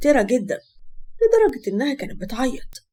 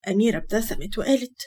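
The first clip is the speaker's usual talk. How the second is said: usual speaking voice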